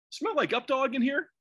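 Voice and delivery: feed the prank line as if sincere; playing it straight. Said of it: "[name] is playing it straight".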